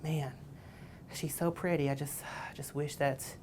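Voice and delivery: soft, partly whispered longing with a sigh; lonely pining. Retold or imitated. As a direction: sigh over a girl you can't approach. Lonely guy pining over girl.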